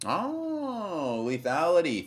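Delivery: high, drawn-out "oh" into a loud name; delighted greeting. Real, not acted. Streamer thanks a viewer for a gift, delighted. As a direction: gush over a generous supporter.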